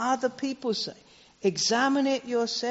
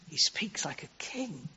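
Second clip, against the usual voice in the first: hushed, breathy whisper for effect; 6 semitones lower, 11 dB quieter than usual. Real, not acted. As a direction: drop to an awed whisper. Preacher drops to a whisper for emphasis.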